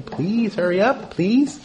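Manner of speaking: high, rising polite plea; polite urging. Shown as a demonstration. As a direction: nudge someone politely to hurry. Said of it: "polite way to tell someone to hurry up".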